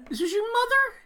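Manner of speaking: high, loud appalled question. Real, not acted. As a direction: ask in outraged disbelief. Shocked at the mother's words.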